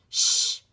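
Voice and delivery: sudden loud hissing shh; sharp hissed shush. Acted. Annabelle silencing Tiffany, a sharp hissed shush. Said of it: "hissed Annabelle suddenly".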